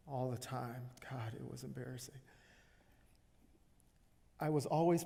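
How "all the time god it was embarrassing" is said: soft, embarrassed aside; quietly sheepish admission. Acted. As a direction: drop the volume, embarrassed by the memory. Recalling an embarrassing childhood memory.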